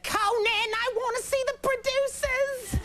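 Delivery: very high, drawn-out caricature of a pleading mother; Edith Bunker voice. Retold or imitated. Comedian mimics his mother asking for theatre tickets, Edith Bunker voice. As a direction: imitate an excited mom in a screechy falsetto. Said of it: "So it was Edith Bunker".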